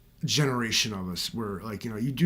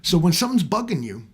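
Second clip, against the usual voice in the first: ordinary talk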